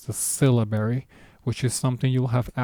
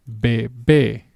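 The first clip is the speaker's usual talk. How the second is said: bebé, light first "be", louder punched "bé"; stress on final syllable; 4 dB louder than usual. Shown as a demonstration. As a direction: exaggerate the force on the end of bebé. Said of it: "It's almost like you go".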